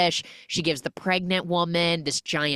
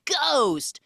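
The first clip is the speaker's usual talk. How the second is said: gleeful refrain; 9 semitones higher than usual. Pretend. Punchline refrain over montage.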